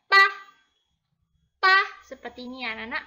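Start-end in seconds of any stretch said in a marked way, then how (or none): 0.1-1.8 much louder, teacher modelling pa; loud model reading. Shown as a demonstration. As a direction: model pa loudly for the class. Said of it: "jadi bunyinya pa". (guru membaca pa dengan keras)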